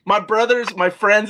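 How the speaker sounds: ordinary delivery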